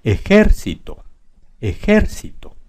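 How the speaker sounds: ejército stressed e-JÉR-cito; stress on GER. Shown as a demonstration. Teacher moving the stress to ger, e-JÉR-cito in ejército. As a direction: hit JER in ejército, the rest lighter. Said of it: "Vamos a ponerla en ger".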